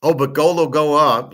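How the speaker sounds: lightly hammed-up mockery of a gold pundit; mocking imitation. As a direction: mimic him like a clueless pundit. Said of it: "hard for me to listen to somebody like that".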